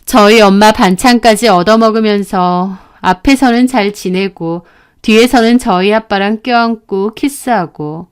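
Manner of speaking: normal talking voice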